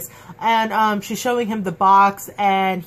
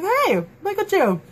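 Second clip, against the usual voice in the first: pleased surprise; 11 semitones higher than usual. Retold or imitated. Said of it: "I'm like".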